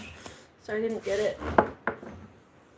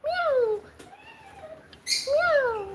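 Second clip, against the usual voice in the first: very high imitated cat meow; mock meow. Copying her crying cat with a high meow.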